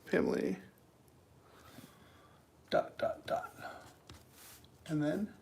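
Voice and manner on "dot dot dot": whisper. Acted. Dramatic pause at wall reveal.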